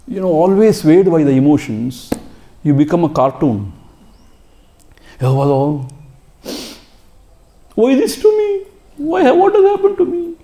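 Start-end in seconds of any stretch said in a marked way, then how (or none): 5.2-10.3 high, wailing why-me lament; self-pitying whine. Retold or imitated. A self-pitying devotee wailing to the teacher, high whine. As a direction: wail it like a helpless crybaby. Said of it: "If you go on crying".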